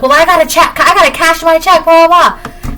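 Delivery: a pushy customer brushing off the rules; whiny customer. Retold or imitated. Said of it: "The person's like".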